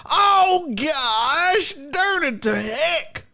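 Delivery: high, pained, drawn-out wail of dismay; mock a coach who won't cuss. Mocking the losing coach, high, slow whine of dismay. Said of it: "I like that he doesn't cuss".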